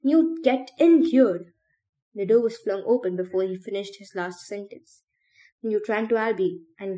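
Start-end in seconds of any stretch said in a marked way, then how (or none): 0.0-1.5 high, loud, drawn-out call for help; slow, urgent raised-voice summons; screamed. A narrator's reading. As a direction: shout for someone in the next room. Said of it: "he screamed".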